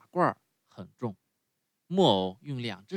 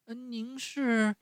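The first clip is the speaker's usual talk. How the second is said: much higher, slow, halting; stammering; 11 semitones higher, slower than usual. A narrator's reading. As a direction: stumble over the words in awe. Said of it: "结结巴巴地回答".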